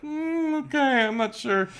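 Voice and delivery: very high, doubtful put-on voice; unimpressed. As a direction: act politely put off and unsure. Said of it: "they're like".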